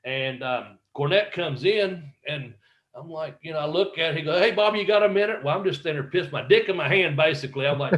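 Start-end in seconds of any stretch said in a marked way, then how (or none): 4.4-5.4 quick, higher-pitched imitation of a colleague; casual hail. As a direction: call over casually, quick and high. Retold boss casually calling to him.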